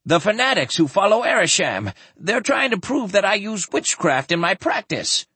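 high, agitated voice; fearful protest. Acted. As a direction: pour it out in alarm, pitched right up. Frightened doctor explains his suspicion.

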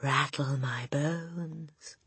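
slow, soft ghostly drawl; spooky voice. A narrator's reading. Parrot imitates a spooky voice, eerie and slow. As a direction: draw every word out like a spook. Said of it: "imitation of a spooky voice".

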